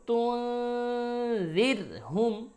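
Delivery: তুনজিরহুম with a long, high hummed n; nasal ghunnah. Shown as a demonstration. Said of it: "তাহলে কি করব?". (তুনজিরহুম-এর নুনে লম্বা গুন্নাহ)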